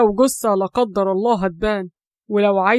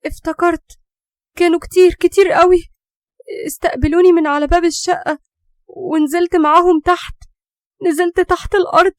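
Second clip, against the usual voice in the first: put-on much higher, trance-like frightened girl; haunted recollection; 8 semitones higher than usual. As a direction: recall the fire and faces in dread. Girl recalls underground visions. (بنت مرعوبة بتفتكر اللي شافته تحت الأرض)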